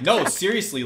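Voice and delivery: high-pitched mock indignation; imitated insistence. Imitates opponent insisting angrily.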